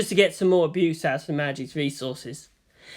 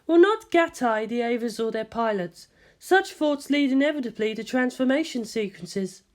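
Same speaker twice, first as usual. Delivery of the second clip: higher, composed scientist voice; Ritsuko's voice; 7 semitones higher than usual. A narrator's reading. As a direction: speak as the measured senior scientist.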